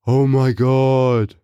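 slow, drawn-out mock amazement; mock shock. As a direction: stretch it out as if stunned. Mock shock at her own theory.

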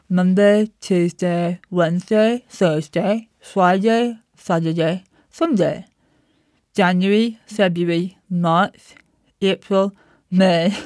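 talking with the tongue out. Shown as a demonstration. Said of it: "put your tongue out on your lower lip".

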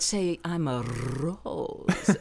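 put-on slow, drawn-out coaching of a child's line; a mother patiently feeding her child a line; coached line. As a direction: spell it out slowly like a mother rehearsing her kid. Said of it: "And she said".

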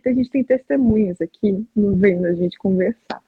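ordinary talk

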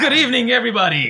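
high, loud booming host greeting; loud announcer. Acted. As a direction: boom out a showman's welcome. Hyped intro reply.

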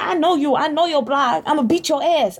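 high-pitched imitation of a threat; mock ranting. Friend replaying the stranger's threat for laughs, high mock rant. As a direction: mimic a ranting stranger, drawing out the words.

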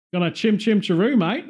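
put-on Aussie tradesman's accent; mock Australian accent. Posing as an Australian chimney cleaner, mock Australian accent. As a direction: pitch it up like a cheery Australian ad. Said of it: "Sounds like an Australian chimney cleaner company".

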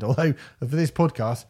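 ordinary talk, nothing marked

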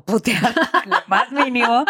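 laughing through the words; words pushed out on a laugh. Real, not acted. Laughing while speaking. (riéndose mientras dice "lo más mínimo")

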